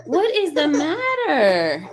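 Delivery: high, loud, drawn-out plea; stretched-out, frazzled cry of frustration; exasperated. Real, not acted. Exasperated at her crying baby.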